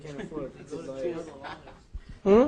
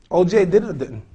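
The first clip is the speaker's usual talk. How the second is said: usual speaking voice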